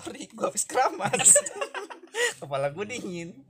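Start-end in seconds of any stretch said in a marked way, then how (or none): 0.0-2.4 laughing. Pretend. Acted reply of a shampooed man.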